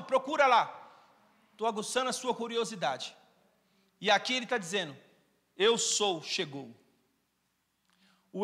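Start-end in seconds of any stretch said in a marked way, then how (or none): 5.6-6.7 very slow, lower, weighty proclamation; slow solemn. Acted. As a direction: lay each word down heavily. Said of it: "pregação enfática".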